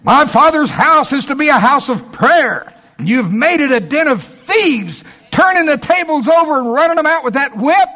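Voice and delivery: high, loud preacher shout; angry, thundering rebuke. Acted. Jesus driving traders from the temple, shouting angrily. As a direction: thunder it out in outrage, high-pitched.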